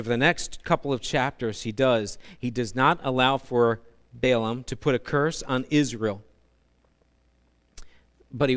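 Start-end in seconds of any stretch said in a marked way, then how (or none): none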